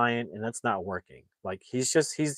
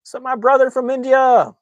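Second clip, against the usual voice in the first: shouted greeting; 13 semitones higher, 9 dB louder than usual. Real, not acted. Streamer greets an arriving viewer, high shouted greeting. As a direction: call out a big, high welcome.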